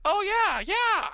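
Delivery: high, loud eager "oh yeah yeah"; excited. Retold or imitated. Teammate recognizing the YouTuber he admires, excited high squeal. Retelling the gamer's eager reply.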